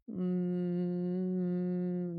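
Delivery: long, soft steady hum; humming. Retold or imitated. Woman soothing her shaking body, soft steady humming. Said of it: "So I start humming".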